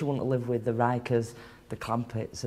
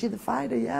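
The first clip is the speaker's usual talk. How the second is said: higher-pitched imitated royal small talk; Prince Charles; 8 semitones higher than usual. Prince to the boxer he is honouring, high polite imitation. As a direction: lightly mimic a royal asking politely, pitched up.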